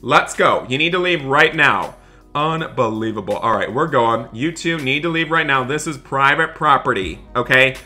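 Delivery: ordinary delivery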